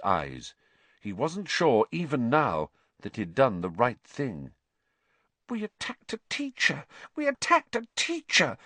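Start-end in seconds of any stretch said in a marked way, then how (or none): 5.5-8.6 put-on very high, panicky girl's whimper; squeaky, high-pitched panic; frightened whimper. A narrator's reading. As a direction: whimper in horror at what you have done. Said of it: "Hermione whimpered".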